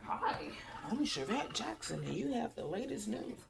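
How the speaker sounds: put-on news anchor greeting; mock newscaster. Vlogger mocking a newscaster opening the news, put-on anchor voice. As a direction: swoop the pitch in a parody of a newsreader. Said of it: "talking like some, um, newscaster".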